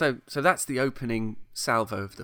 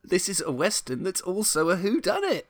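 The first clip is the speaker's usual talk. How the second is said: much higher, thrilled voice; excited realisation; 9 semitones higher than usual. Retold or imitated. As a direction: relive the thrill of discovering it. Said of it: "can sense the excitement in my voice".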